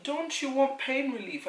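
high, loud, slow question; imitated insistent midwife. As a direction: press the question loudly as the midwife. Imitates midwife pressing her with question.

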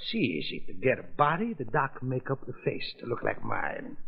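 put-on thick foreign-accented gangster, taunting; menacing mobster in broken English; lightly accented mob boss toying with the judge.

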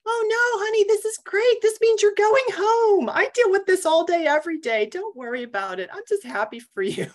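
hammed-up cheery fussing, easing off at the end; gushing reassurance. Attendant comforting embarrassed patient, effusively reassuring.

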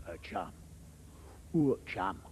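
low London menace; threatening Londoner. Acted. Londoner calling someone chum, low threatening London accent. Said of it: "The Londoner".

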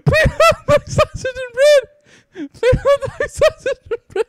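high, loud orders through giggles; laughing. Real, not acted. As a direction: call the orders while cracking up. Reading orders while laughing.

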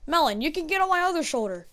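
normal talking voice

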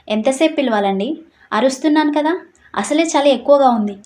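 ordinary delivery, nothing marked

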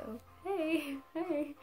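small, high-pitched awkward hey; awkward greeting. Retold or imitated. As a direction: greet him shyly and uneasily. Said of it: "kind of an awkward kind of hi".

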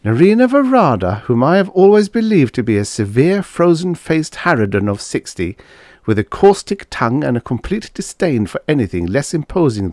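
high-pitched, animated mock-formal recital; amused. A narrator's reading. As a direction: reel it off in amused amazement. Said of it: "he chuckled".

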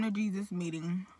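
chewing food; talking between bites; mouth full. Real, not acted. Seen 5: bites a fry at 1.9–2.3, then chews while speaking.

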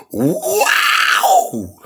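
high, drawn-out wow; long, high cry of disbelief; exasperated shout. Real, not acted. Exasperated outburst at falling price.

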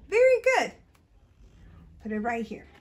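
normal talking voice

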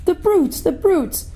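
high, grieving outburst; tearful. A narrator's reading. Lucy watching Aslan mocked, high tearful outburst. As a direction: spit it out through tears. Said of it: "tears streaming down her cheeks".

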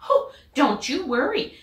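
confident reassurance. Acted. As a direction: brush off his worry cheerfully. Missus Mallard reassuring.

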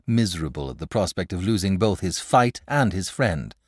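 ordinary delivery, nothing marked

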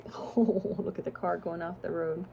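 plain reading voice

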